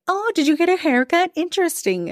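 high, sing-song Minnesota nice "Oh, interesting". Retold or imitated. Mimicking an acquaintance who judges politely, in high Minnesota nice. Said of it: "Minnesota nice".

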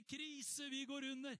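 high, loud mock panic; panicked cry. Disciples in the storm crying out in mock panic. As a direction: cry out as if the boat is sinking. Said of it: "alle ropte".

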